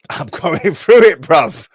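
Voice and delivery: laughing through the words despite the topic; a pained laugh breaking into the line. Real, not acted. Telling viewers what he is going through, laughing. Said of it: "Don't know why I'm laughing".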